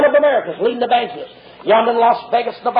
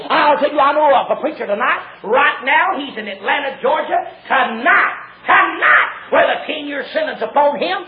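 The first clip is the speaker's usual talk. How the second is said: high, driving revival holler; urgent pulpit cry, breaking into shouts; full-force preaching; 4 semitones higher, 4 dB louder than usual. Real, not acted. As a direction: build to a shouted denunciation.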